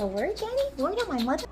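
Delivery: a dog swearing on her mother, squeaky; high dog voice. Pretend. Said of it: "She said".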